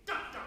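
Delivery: two short, separated da hits; detached marcato das. Shown as a demonstration. Conductor demonstrates two eighths, extremely short and detached. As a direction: punch each da, nothing touching. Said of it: "nothing touches".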